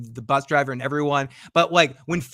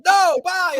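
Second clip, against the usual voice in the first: much higher and louder, a hurried brush-off; panicked bye; 13 semitones higher, 5 dB louder than usual. Retold or imitated. [name] escaping the van guys, high panicked bye. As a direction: blurt it out and bolt. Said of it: "I was like".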